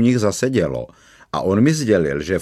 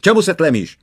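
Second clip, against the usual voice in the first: high, loud snap of a question; sudden roar; 9 semitones higher, 8 dB louder than usual. A narrator's reading. Policeman roaring at the laughing boy, sudden yell. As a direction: bark it at the grinning boy. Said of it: "zařval na mě najednou".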